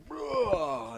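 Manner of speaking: high, loud yelp of Bruh; a sudden squeaky shout; mock yell. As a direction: blurt it out high and loud like a startled kid. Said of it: "Vibe to fucking go".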